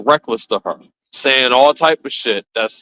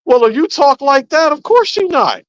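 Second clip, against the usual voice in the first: high, teasing jab; mocking high pitch; 13 semitones higher than usual. Mocking the men's soft talk.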